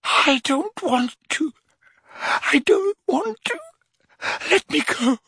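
high, slow, broken pleading; pleading in anguish. A narrator's reading. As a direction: beg weakly to be spared. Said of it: "he groaned".

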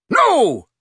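sharp loud refusal. A narrator's reading. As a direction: cut him off. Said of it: "said the fourth Death Eater sharply".

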